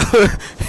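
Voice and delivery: breathy giggling burst before the punchline; burst of laughter. Real, not acted. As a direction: laugh out loud at the memory. Laughing at a crude story.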